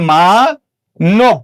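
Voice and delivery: মা-নব very slowly, long maa, then nob; syllable-by-syllable pronunciation. Shown as a demonstration. Teacher answers how it is pronounced, syllable by syllable. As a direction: break মা-নব in two with an o in the second part. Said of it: "মানবটা উচ্চারণে কি হবে?".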